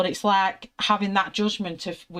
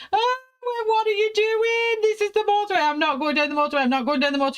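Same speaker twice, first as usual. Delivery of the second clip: very high, louder frantic protest; panicked shriek; 4 dB louder, faster than usual. Retold or imitated. On the slip road, shrieking at her husband in high panic. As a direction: shriek it like the car's out of control. Forced onto the motorway, panicking.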